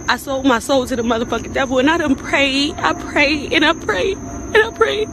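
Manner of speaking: desperate plea climbing into tears; breaking down. Real, not acted. Tearful confession to fans, breaking down.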